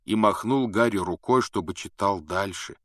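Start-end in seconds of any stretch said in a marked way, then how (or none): none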